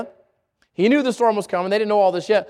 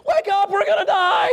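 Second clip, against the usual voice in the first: panicked shout; 10 semitones higher than usual. Retold or imitated. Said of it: "It was like".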